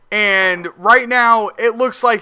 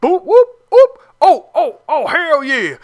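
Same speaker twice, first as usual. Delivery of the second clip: high, loud whoops of joy; hyped whooping; 7 semitones higher, 4 dB louder than usual. Retold or imitated. Gamer cheering his own scoring drive in rhythm, hyped whoops. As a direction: cheer it out like the drive just clicked. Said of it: "I was like".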